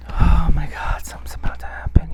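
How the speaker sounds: fast frightened whisper; fearful whisper. Retold or imitated. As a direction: breathe it out in dread. Dreading the other shoe.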